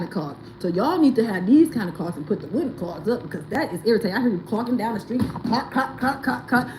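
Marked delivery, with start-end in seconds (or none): 5.2-6.7 clog clacking. Retold or imitated. Imitating clog sounds.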